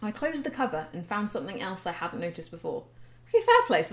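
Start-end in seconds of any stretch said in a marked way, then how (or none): 3.3-3.8 surprised concession. Real, not acted. Reacts to her old writing.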